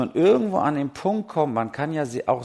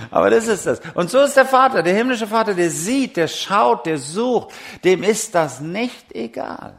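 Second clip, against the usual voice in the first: much higher and louder, preaching with fire; fervent preacher's call, much higher and louder; full-force preaching; 9 semitones higher, 7 dB louder than usual. Acted. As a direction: proclaim it to the whole hall. Preaching about the heavenly Father.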